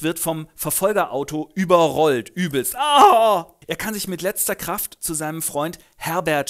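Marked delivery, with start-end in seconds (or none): 2.8-3.5 lauter, hoher Schmerzensschrei. Acted. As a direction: schrei auf, als das Auto dich überrollt. Franz wird überrollt. (Franz überrollt, lauter Schmerzensschrei)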